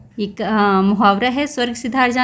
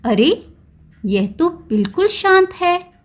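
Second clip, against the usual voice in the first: ordinary talk, nothing marked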